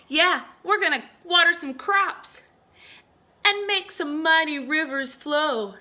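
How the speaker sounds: high, loud boasting; loud proud cloud boasting; boastful cloud. Acted. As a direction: brag with gusto. Another big cloud boasting.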